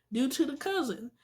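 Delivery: ordinary talk, nothing marked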